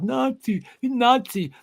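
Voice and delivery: high sneering mock cry of Nazi; mocking protester. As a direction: parody a spoiled kid yelling. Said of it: "that just fires me up".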